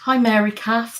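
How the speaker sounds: normal talking voice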